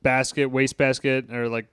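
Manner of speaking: normal talking voice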